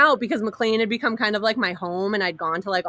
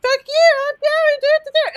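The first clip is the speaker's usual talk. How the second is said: high, loud furious yell at the guards; defiant yell; 7 dB louder than usual. Retold or imitated. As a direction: scream it right back at them. Defying security guards, yelling.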